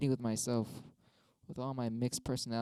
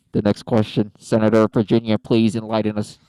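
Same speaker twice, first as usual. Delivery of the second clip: loud, formal announcer call; hammed-up debate host summons; mock moderator; 3 semitones lower, 15 dB louder than usual. Moderator asking the Senator of Virginia, loud announcer call.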